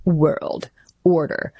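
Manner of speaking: slow, low menacing imitation; Mark Carney. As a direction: mimic an ominous politician, drawing out each word. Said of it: "the way he says that is so menacing".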